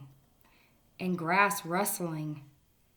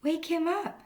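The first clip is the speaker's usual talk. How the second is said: a touch of a high squirrel; lightly voiced eager squirrel; 10 semitones higher, 3 dB louder than usual. Squirrel advising the rabbit, lightly high squirrel voice. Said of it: "said the squirrel".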